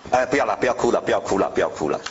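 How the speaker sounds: put-on a father calming his child, gently soothing. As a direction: hush a crying kid gently.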